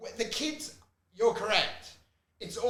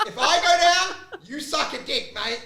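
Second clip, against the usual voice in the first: mock defiant shout; 7 semitones higher, 5 dB louder than usual. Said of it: "I'm like".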